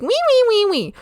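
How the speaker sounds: high, loud wee noise; vocal stim. Shown as a demonstration. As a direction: squeak out a playful wee. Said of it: "Mine are like".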